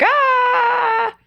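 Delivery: high, sudden cry of despair; hammed-up wail; mock anguished cry. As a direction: let out a short anguished "Ah". Mimics her old despair.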